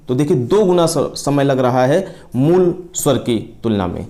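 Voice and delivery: ordinary talk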